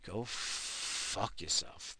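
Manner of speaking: slow breathy hush, voice dropped away; slow whispered send-off; hushed curse. Acted. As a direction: hiss the curse slowly under your breath.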